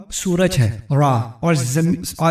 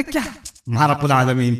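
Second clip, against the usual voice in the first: scornful Pharaoh; 5 semitones lower than usual. Retold or imitated. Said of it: "فرعون کہتے".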